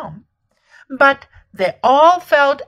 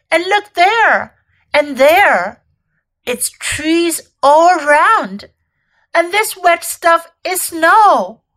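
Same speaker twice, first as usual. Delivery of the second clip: loud, raised voice full of wonder; acted amazement, pitched up; excited discovery; 5 semitones higher, 5 dB louder than usual. A narrator's reading. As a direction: point out each new marvel. Children discovering the snowy wood.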